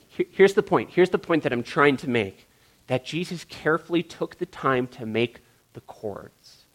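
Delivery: usual speaking voice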